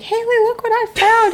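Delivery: high, slow eager calling; excited kid voice. Retold or imitated. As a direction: show off your find proudly. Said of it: "come running and be like".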